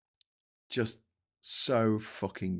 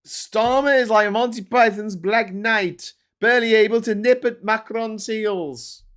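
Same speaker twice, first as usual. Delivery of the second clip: mock headline read; 12 semitones higher, 10 dB louder than usual. Reading headline mockingly.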